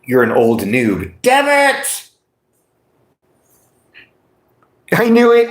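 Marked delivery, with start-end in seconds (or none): 1.2-5.5 high loud mock frustration; frustrated shout. Real, not acted. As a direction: yell out in frustration. Reacting to being called noob.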